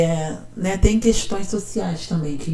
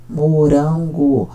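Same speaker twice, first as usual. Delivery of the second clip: "Morango" drawn out slowly, syllable by syllable; much slower than usual. Shown as a demonstration. Teacher showing how the child read, slowly sounding out. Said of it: "Porque ela leu até aqui".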